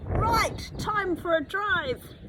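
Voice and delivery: high, bright-voiced eager uncle; eager Nico. A narrator's reading. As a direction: sing it out high with a clap. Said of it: "Nico claps his hands".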